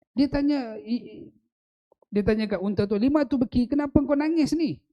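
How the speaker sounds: high, loud impassioned preaching; preaching at full force. Acted. Preacher tells the congregation of Mount Uhud laughing, high and loud. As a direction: lift the crowd with a loud, soaring telling.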